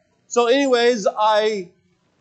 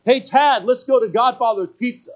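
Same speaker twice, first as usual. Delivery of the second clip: calling out; 8 semitones higher, 5 dB louder than usual. Retold or imitated. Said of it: "Ben yells out".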